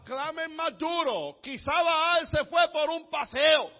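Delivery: high, slow jeering call; mocking taunt. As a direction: taunt them loudly, stretching the words. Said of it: "se burlaba de ellos y decía".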